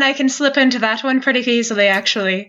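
easy, chatty Irish lilt; conversational Irish accent. Shown as a demonstration. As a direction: slip lightly into an Irish accent. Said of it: "Like an Irish one".

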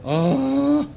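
high startled oh; loud shocked gasp. Acted. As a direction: gasp at the sight of blood. Sees the blood.

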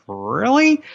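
high, rising disbelief; incredulous producers. Retold or imitated. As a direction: imitate their incredulous double take. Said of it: "And they're like".

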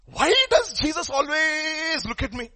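put-on high, drawn-out child's complaint; whining grandson. Grandson questions his grandfather about Jesus, whining child's voice. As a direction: whine it up high like a puzzled grandchild. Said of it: "grandson goes to his grandfather".

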